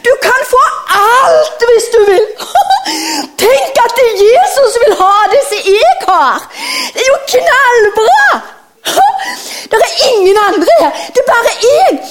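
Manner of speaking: put-on squealing, giggling boy shouting with joy; excited boy. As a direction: gush like a thrilled child giving everything away. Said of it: "Og så sier gutten".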